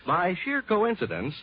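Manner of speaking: ordinary delivery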